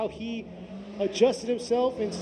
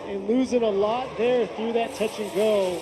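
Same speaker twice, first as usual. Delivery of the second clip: raised, louder excited race call; live play-by-play; 5 semitones higher, 6 dB louder than usual. Real, not acted. Commentator calling Goto's second run, excited play-by-play. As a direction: push the energy as the car struggles.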